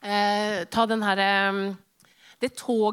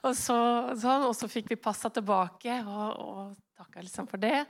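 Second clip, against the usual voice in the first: ordinary talk, nothing marked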